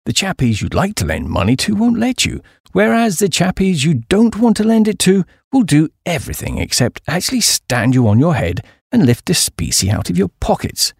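usual speaking voice

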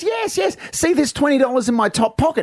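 high, emphatic husband pleading his case; acted high-pitched earnestness; eager insistence. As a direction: insist on the alibi, voice pitched right up. Husband eagerly proves his alibi.

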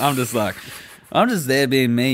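normal talking voice

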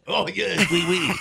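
a light, hammed-up French accent; mock French accent. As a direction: toss off a hint of French accent. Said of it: "Bit of a French accent".

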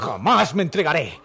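defiant shout. Acted. Cornered fugitive defies her.

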